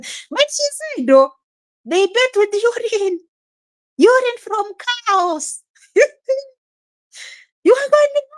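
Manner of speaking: high-pitched, giggling through the words; laughing high disbelief. Real, not acted. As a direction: laugh it out, hardly believing it. Laughing at the urine idea.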